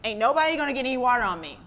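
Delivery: high, loud, fast cocky boast; teen bragging. Retold or imitated. Cool teen Nigel warns the campers, loud cocky boast. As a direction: show off like the coolest kid at camp. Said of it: "and he said".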